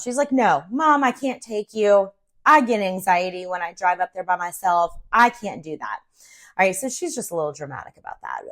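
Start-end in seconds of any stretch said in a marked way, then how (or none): none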